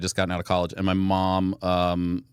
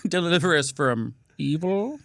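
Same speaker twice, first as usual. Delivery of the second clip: higher, hammed-up supervillain prayer; Doctor Evil; 5 semitones higher than usual. Shown as a demonstration. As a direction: draw out the last word like a movie villain. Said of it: "comes out a little Doctor Evil".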